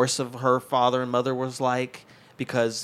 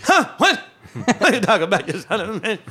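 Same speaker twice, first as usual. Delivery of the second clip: high-pitched East Coast outburst, half laughing; hammed-up loud, indignant comic rant; Bernie Mac; 7 semitones higher, 4 dB louder than usual. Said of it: "It sounded like Bernie Mac".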